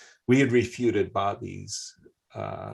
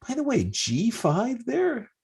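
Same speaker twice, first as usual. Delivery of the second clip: much higher, baffled; incredulous surprise. Real, not acted. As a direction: pitch it up as if the move makes no sense. Surprise at odd chess move.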